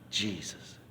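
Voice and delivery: very soft, low utterance; quiet, as if thought. Retold or imitated. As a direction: say it like a thought just arriving. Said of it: "all of a sudden I thought".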